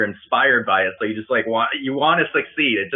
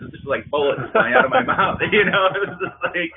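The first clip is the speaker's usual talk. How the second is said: laughing; 9 semitones higher than usual. Real, not acted. Laughing at own fast talking.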